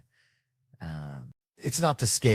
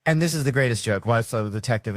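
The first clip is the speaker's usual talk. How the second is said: normal talking voice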